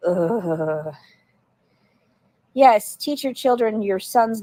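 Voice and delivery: usual speaking voice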